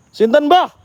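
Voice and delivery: high loud Javanese call; shouted question to the grandfather spirit. Real, not acted. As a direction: ask who is there, calling loudly into the dark. Calling to unseen spirit.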